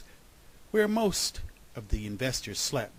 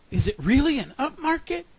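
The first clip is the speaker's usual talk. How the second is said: high, loud, incredulous query; amazed question; 13 semitones higher, 10 dB louder than usual. A narrator's reading. Hedge fund checking the rumour, amazed question. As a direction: lean hard on wanting it true.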